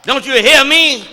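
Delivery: put-on shouted call to a boy, high and loud. Shown as a demonstration. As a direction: yell across the yard for the boy. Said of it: "Cry out".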